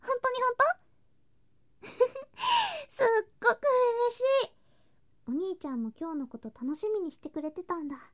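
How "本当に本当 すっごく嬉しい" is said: high, loud, thrilled disbelief turning to joy; bursting with happiness, voice cracking high; overjoyed squeal. Acted. Overjoyed at brother missing her. (本当に？と声を裏返して大喜び)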